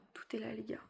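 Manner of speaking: hushed whisper, almost breathless. Real, not acted. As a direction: drop to a whisper. Showing belly to viewers.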